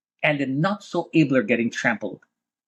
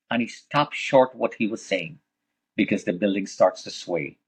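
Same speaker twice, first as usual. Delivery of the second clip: ordinary delivery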